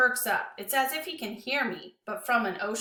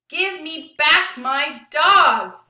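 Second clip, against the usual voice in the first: very loud, high, slow enraged scream; furious scream; 6 semitones higher, 12 dB louder, much slower than usual. Acted. Lewis screaming after the fleeing kids, enraged. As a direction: scream it after them in rage. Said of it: "he screams".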